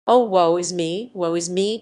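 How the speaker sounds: lightly voiced despairing cry; cried in woe. A narrator's reading. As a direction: wail over a cruel fate. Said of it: "cried the lieutenant".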